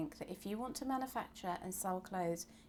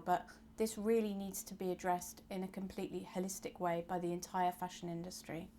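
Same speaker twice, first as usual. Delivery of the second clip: ordinary talk, nothing marked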